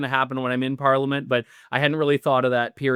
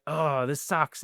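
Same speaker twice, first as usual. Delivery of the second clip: mock dejection; 3 semitones higher, 4 dB quieter, slower than usual. Disappointed supporters to the candidate at the office, mock dejection. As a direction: softly imitate a deflated supporter. Supporters' letdown, retold after expecting excitement.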